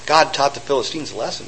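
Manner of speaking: usual speaking voice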